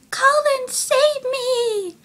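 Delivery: high, drawn-out damsel wail; playful high-pitched plea; mock cry for help. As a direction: call out for rescue, hammed up. Acts Bella crying for rescue.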